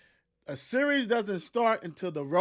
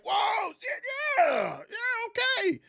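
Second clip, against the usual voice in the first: much higher mock whoa of joy; surprised delight; slower than usual. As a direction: act like you just found cash. Finding ten dollars excitement.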